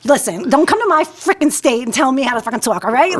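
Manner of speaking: put-on indignant New York accent. New Yorker snapping back at mocking classmates, indignant New York accent. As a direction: tell them off in thick New York. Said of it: "making fun of my accent".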